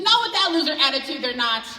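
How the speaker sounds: high, loud, defiant mom; fierce mom. Retold or imitated. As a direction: snap back at the husband, loud and proud. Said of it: "She said".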